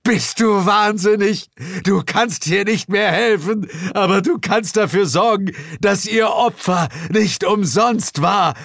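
high, loud pleading yell; Bill shouts at his friend to stop; urgent shout. Acted. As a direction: shout sense into him. Bill holds John back.

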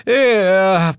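loud, high bark; bellowed. A narrator's reading. Devil at the counter bellowing in disbelief. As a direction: bark the one word out. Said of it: "bellte der Mann am Tresen".

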